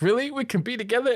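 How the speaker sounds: high, hopeful, lovestruck question; playful retold excitement; mock delighted grandfather. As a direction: imitate giddy disbelief, voice leaping up.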